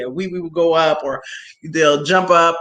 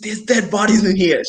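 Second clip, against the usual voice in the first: scared. Retold or imitated. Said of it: "I said".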